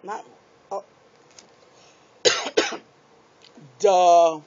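ordinary delivery

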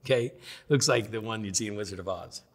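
normal talking voice

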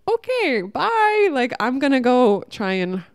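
mock a bully scrambling away; scared-off attacker. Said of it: "they just are like".

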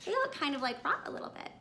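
ordinary delivery

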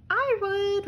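high, small fish voice; little lanternfish. A narrator's reading. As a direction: pipe up like a tiny fish. Lanternfish answers crab.

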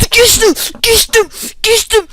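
high, loud triumphant yells; excited shouts. Real, not acted. Gamer cheering that he made it through, triumphant shouts. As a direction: bark it out in triumph each time. Player celebrates getting past an obstacle.

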